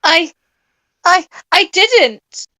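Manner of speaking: stammering high, slow denial; sputtering. Acted. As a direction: sputter it out in panic. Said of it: "I sputtered".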